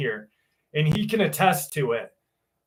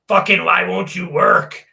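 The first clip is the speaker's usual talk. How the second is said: mock angry yell at his own hands; hammed-up dad yelling in frustration; imitated yelling; 4 semitones higher, 6 dB louder than usual. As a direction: yell like an exasperated dad, louder than usual. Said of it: "He yells at his hands".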